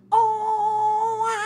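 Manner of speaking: put-on baby's cry sung high and loud on a melody; loud, high, melodic newborn cry. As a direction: cry like a baby hitting a musical scale. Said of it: "Ada tangga nadanya".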